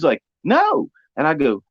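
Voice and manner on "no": lightly voiced girlfriend. Kaylee's answer voiced.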